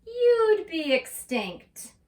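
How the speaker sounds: high, loud, drawn-out zebra line in a read-aloud poem; theatrical pronouncement. Acted. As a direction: declaim it slowly and loudly to the dinosaur. Zebra taunts dinosaur in poem.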